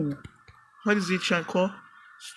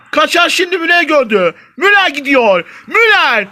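rising play-by-play call, louder to a shout; mock commentator build-up; 9 semitones higher, 7 dB louder than usual. Gamer calling his attack as a commentator, building to a shout.

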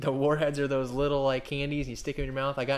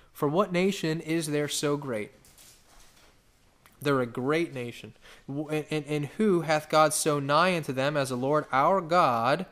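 slow, louder solemn reading; scripture reading; 5 dB louder, slower than usual. Acted. Preacher reading Deuteronomy to the church, slow and solemn. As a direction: draw out the verse with reverence.

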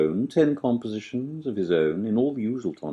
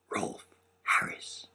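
stage whisper; 10 dB quieter than usual. Acted. Whispered punchline in poem.

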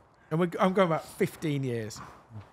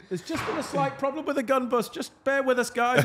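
higher-pitched mock reassurance to waiting guests; placating announcement; 9 semitones higher than usual. Host explaining a gun bus problem, placating. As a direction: stall the group with a strained apology.

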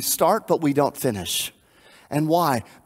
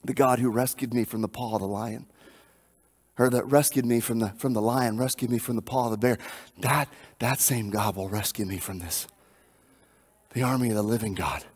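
softer and lower, calm and sure; quiet confidence; 4 semitones lower, 5 dB quieter than usual. Retold or imitated. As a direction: speak it as a settled, quiet faith. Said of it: "And David says this".

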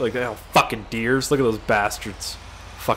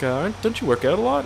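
put-on girl at the counter; 7 semitones higher than usual. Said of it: "And the girl's like".

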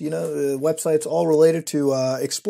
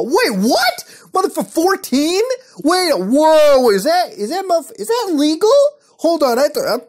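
higher, louder shocked outburst; stunned, demanding an answer; shocked disbelief; 9 semitones higher, 7 dB louder than usual. Real, not acted. Shocked at age fourteen.

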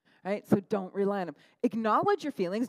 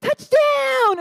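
a high, loud "Touchdown!" yelled like a fan; excited shout; 13 dB louder than usual. Retold or imitated. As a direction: cheer it out like your team just scored. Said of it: "And he's like".

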